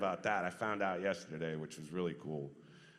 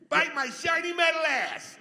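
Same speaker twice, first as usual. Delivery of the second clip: much higher and louder robot voice; robot catchphrase; 11 dB louder than usual. Pretend. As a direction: belt out the catchphrase for the fans. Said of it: "There you go".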